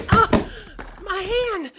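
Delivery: high yelp of pain; pained cry. Acted. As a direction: cry out as his hand is crushed. Hand being crushed.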